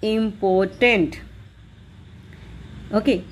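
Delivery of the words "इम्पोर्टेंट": "इम्पोर्टेंट" in three even beats, im-por-tent; divided in three. Shown as a demonstration. Teacher dividing the word for students, "इम्पोर्टेंट" in three syllables. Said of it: "तीन में divide हो".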